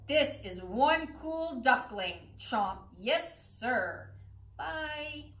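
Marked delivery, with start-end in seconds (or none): none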